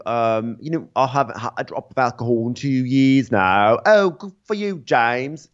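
put-on two men in a British accent-off. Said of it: "British accent off happening between".

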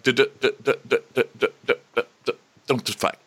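repeated stuttered d before the words; mock stuck-record stutter; imitated stammer. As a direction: stammer on the first sound, then rush it out.

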